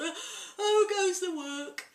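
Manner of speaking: high, loud, slow startled call. Acted. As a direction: stretch it out as the bed rocks. Farmer wakes, bed rocking.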